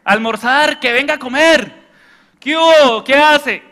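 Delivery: high, loud calling out; household yelling. Retold or imitated. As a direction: shout through the house for lunch. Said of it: "Y se peleaban".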